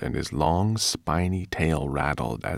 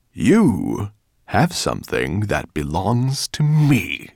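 slow, louder menacing dragon growl; dragon's growl; 3 semitones higher, 6 dB louder, slower than usual. Acted. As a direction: drag each word out like a looming dragon. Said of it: "growled Nettlebrand".

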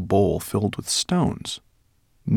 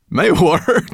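giggling; 12 semitones higher, 9 dB louder than usual. Acted. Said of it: "giggling at the sight of him".

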